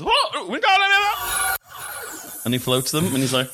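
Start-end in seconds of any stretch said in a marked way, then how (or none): 0.0-2.5 high, frantic cried-out incantation; flustered spell-caster. Acted. Professor catches the falling bricks, high panicked spell cry. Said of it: "he, he, he goes".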